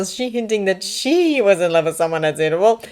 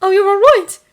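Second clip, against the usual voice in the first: concerned check; 11 dB louder than usual. A narrator's reading. Checking on a choking man.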